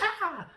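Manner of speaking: high, loud mock scream of fright; retold scream from a scared actress; imitated scream. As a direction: yelp like a live wire just sparked. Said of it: "her scream is real".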